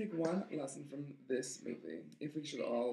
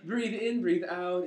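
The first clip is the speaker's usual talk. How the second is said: loud, higher "breathe in, breathe out"; mock dramatic breathing; 5 semitones higher, 7 dB louder, much slower than usual. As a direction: ham up the big awakening.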